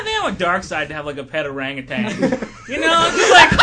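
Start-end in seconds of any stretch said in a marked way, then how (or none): 2.7-3.6 high squealing laugh. Real, not acted. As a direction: dissolve into a high-pitched laugh.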